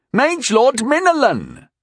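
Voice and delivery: high, slow shouted name; exasperated storybook shout. A narrator's reading. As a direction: call it out, stretched and high. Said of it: "shouted Sir Serbaral".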